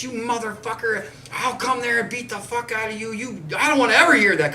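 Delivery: high, loud furious threat; furious old friend. Retold or imitated. Old friend blows up at his giving up, high furious yell. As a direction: blow up at him like an outraged old man. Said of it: "And he got so mad".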